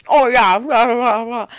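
loud mock mouth-full chatter; imitated full mouth. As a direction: blurt it as if chewing, loudly. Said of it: "talking with my mouth full".

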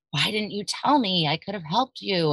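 lightly voiced pleading mom, pitched high; concerned mother, retold. Voices her mother's later reproach.

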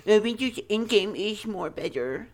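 high, slurred mock-whiny voice; mocking naysayers. Host mimicking rival Marvel fans, mocking. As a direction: put on a silly high voice for dim fans. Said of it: "I don't wanna hear".

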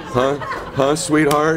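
playful mock seductive coo, laughing; mentor's voicemail teasing him in a hammed-up sexy tone. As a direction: tease like a flirty caller. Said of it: "really, like, sexy voice".

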